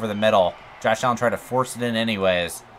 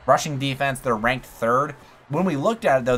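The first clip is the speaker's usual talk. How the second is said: ordinary delivery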